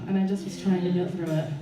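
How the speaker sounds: ordinary talk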